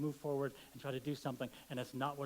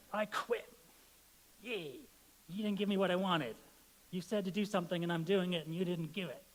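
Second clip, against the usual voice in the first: frustrated outburst; 5 semitones higher, 4 dB louder than usual. Pretend. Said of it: "I'm sure none of us have had that experience".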